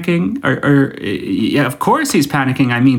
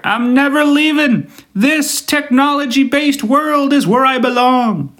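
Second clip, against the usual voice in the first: much higher, slow, dreamy vow; mock devoted tech addict; 11 semitones higher than usual. Phone addict confessing her heart, hammed-up high vow. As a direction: swoon over technology like a hopeless devotee. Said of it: "do you know in your heart".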